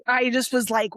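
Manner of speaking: usual speaking voice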